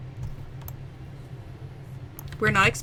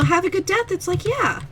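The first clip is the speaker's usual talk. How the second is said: chirpy clerk voice; 8 semitones higher than usual. Retold or imitated. Mock cheerful wish.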